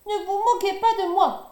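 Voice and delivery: high, loud indignant protest. A narrator's reading. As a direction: stop them laughing at you. Vexed Hiawatha scolds laughing animals. (Hiawatha aux animaux moqueurs, indigné)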